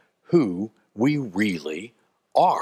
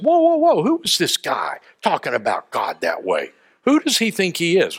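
indignant protest; 6 semitones higher than usual. Acted. Young David objecting to Goliath's taunts, indignant protest. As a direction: jump in high to stop everything, affronted.

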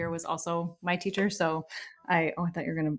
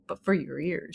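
whispering; 3 semitones lower than usual. Acted. Aside in Garth role.

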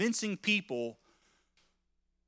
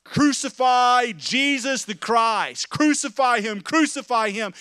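loud, slow, higher crowd yell; violent shout; 4 semitones higher, 8 dB louder than usual. Acted. Preacher voicing the mob crying out for crucifixion, shouting. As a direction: roar it like an angry mob. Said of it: "to violently shout".

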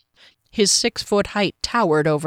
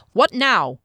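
higher, sharp bark at an interruption; irritated bark; 5 semitones higher than usual. A narrator's reading. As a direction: bark it, annoyed at being disturbed. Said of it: "he barked".

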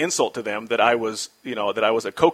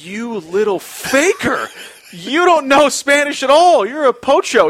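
higher, louder accusation, slowing down; mock betrayed anger; 11 semitones higher, 7 dB louder, slower than usual. Rincon catching the rookie faking Spanish, mock anger. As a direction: let him feel betrayed and furious. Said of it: "cocktail of anger and confusion and betrayal".

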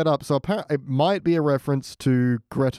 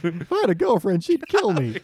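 put-on squeaky teen voice; 7 semitones higher than usual. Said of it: "the squeaky voiced teen".

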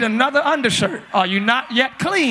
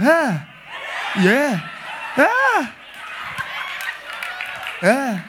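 short interjections as if hearing God; hammed-up devout murmurs and yelps; mock spiritually deep; 3 semitones higher, 4 dB quieter, much slower than usual. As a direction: react to a voice from above, exaggerated. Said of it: "they real deep, like they hear God".

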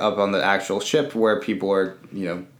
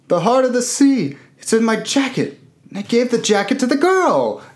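much higher, hammed-up horror; mock panicked realization; 13 semitones higher, faster than usual. Billy Zane realizes he gave away the diamond, high panicked. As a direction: squeal it as the dread dawns.

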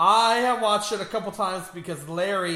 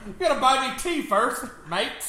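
mock British Spider-Man; 7 semitones higher than usual. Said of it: "That accent's all over the place".

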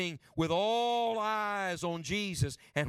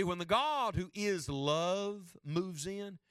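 normal talking voice

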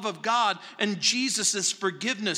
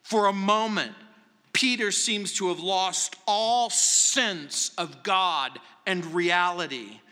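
ordinary talk

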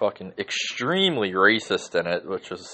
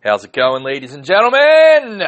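loud, high hyped-up welcome; big greeting; 7 semitones higher, 13 dB louder than usual. Acted. Host greeting his audience, loud and hyped. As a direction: greet the crowd like a ring announcer.

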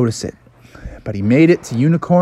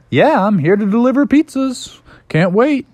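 higher-pitched, upbeat bear character; eager Bubby; 10 semitones higher than usual. Acted. Bubby answers his new boss, eager higher voice. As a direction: play an eager new delivery guy, pitched up.

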